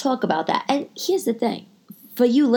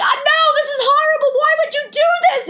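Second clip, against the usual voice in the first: much higher, a squealing mock wail; shrill dismay. Friend kneeling with makeup squeals in horror. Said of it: "she's like".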